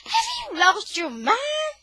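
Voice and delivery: high, loud, slow outraged scold; mother yelling at her son, high and slow; Mama scolding. Acted. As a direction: shriek it like a shocked mother. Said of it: "Mama comes running up and she says".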